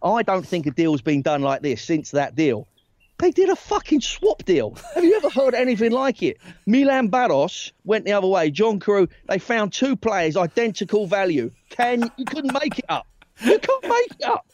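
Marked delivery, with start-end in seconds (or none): 3.2-14.5 high-pitched, laughing disbelief; incredulous outburst. Real, not acted. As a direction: exclaim it high, as if nobody could believe it. Incredulous telling of the swap deal.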